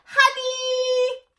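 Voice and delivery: very high loud cooing call; small person cooing. A narrator's reading. Little one asking Elmore for a cuddle, high coo. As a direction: reach out for a cuddle with it. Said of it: "stretch out its arms and say".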